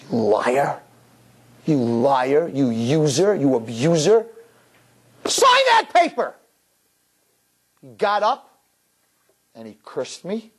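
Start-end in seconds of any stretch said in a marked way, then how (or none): 0.0-4.3 each insult set apart, pitch held down; re-enacted contempt without shouting; angry accusation. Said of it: "I was very angry". 5.2-6.3 very high, drawn-out furious yell; re-enacted outraged command; angry shout. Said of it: "I was very angry".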